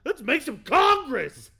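high, loud rousing shout; rally the room at full volume; loud rallying call. Acted. Rallying the congress loudly.

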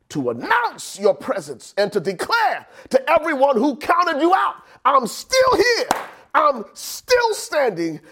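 climbing to a high, defiant cry; high, stretched preacher's proclamation; full-force preaching. Real, not acted. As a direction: build to a triumphant pulpit declaration.

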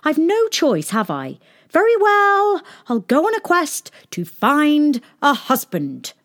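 high, loud resigned princess; cartoon princess. A narrator's reading. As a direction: give in grandly. Princess accepts quest.

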